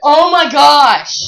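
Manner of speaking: high, drawn-out shout of disbelief; loud exclamation. Real, not acted. Reacting to a friend's shirt remark, loud astonished exclamation. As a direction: cry it out long and very loud.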